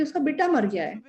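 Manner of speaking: ordinary talk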